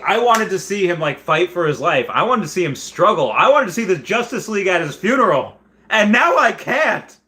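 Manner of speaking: high, loud, aching lament; pleading for what he never got; emotional. Real, not acted. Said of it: "clearly I'm getting emotional".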